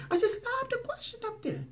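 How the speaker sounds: high, soft mocking imitation; mock-dainty reporter. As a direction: parody her in a tiny high voice. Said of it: "lob is a soft versus throw".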